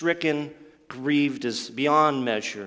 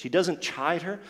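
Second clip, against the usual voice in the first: usual speaking voice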